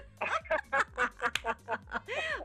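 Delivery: high, extended peal of laughter; real helpless laughter; gleeful laugh. Real, not acted. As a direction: crack up at your own joke. Laughs at her own slogan.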